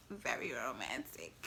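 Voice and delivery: low, hushed whisper. Real, not acted. Woman confiding about her romantic baby daddy, whispered. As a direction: drop right down to a whisper.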